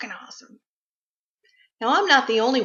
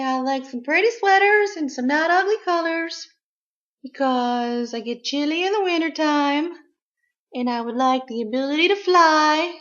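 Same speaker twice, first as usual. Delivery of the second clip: high voice for the pet's wish list; voicing the pet; 5 semitones higher than usual. Owner voices her pet's Christmas wishes, high and sweet. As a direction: speak as the pet asking Santa.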